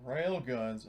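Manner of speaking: normal talking voice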